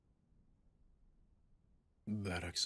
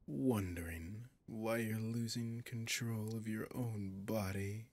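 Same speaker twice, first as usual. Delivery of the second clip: ordinary talk, nothing marked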